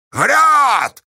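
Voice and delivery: loud, high triumphant cry; gleeful yell. A narrator's reading. Pozdey catching Astasha out, gleeful yell. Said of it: "радостно заорал Поздей".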